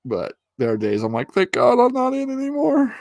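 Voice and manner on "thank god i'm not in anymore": mock relief. Relief at leaving the military.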